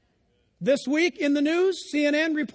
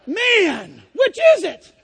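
much higher, louder puzzled cry; mock bafflement; 4 semitones higher, 9 dB louder, slower than usual. Preacher laughing at the cusser's switch, loud incredulous cry. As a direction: throw up your hands, incredulous.

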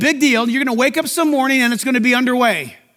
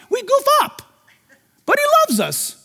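much higher, light voice; high tender aside; 12 semitones higher than usual. Real, not acted. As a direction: lift the voice up gently. Tender aside about church.

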